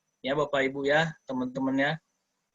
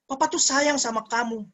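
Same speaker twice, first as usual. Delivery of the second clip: fake loving dad; 9 semitones higher than usual. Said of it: "Jangan bilang sayang".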